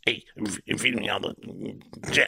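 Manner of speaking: low, quiet grimy worm; a lightly voiced greedy worm; gross worm voice. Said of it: "that's what I should sound like".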